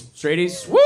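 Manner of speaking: rowdy rising shout of "Straighties?"; shouted callout. Real, not acted. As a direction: yell "Straighties?" up into a question to rally the room. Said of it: "Any other straighties?".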